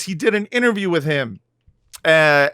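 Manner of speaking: ordinary delivery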